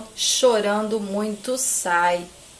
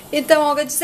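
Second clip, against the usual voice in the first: ordinary delivery, nothing marked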